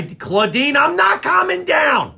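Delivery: high, defiant yell; agitated shout. Acted. Host refuses to calm down, shouting at Claudine.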